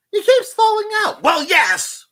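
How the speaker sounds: high put-on voice; squeaky character voice, loud; mock quote. Voicing the other guy, high and loud. Retold dialogue about bent part.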